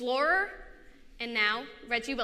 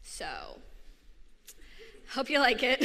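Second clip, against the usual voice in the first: ordinary talk, nothing marked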